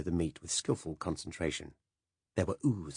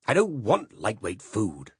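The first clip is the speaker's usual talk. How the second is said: slow, louder growl; 4 semitones higher, 5 dB louder, slower than usual. Acted. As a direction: growl it at her, knife in hand. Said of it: "growled Silas".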